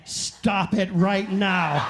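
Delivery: slow, loud, stern command; stern scolding. Retold or imitated. Telling the flossing woman off, slow and stern. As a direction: halt her cold, word by word.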